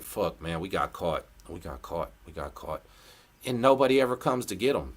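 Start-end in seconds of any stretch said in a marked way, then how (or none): 0.0-2.8 low, defeated grumble fading to almost nothing; dejected inmates fading to a murmur; resigned mutter, fading. Retold or imitated. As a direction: mutter it lower and quieter each time you repeat it. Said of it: "looking like".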